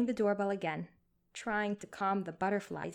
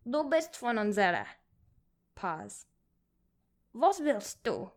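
put-on cranky old man; 2 semitones higher, 4 dB louder than usual. A narrator's reading. Old man answers in Yiddish.

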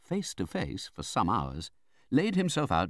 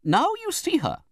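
very high, loud, commanding aunt; Betsy Trotwood; 5 dB louder than usual. A narrator's reading. Aunt presents herself to the widow, imperious.

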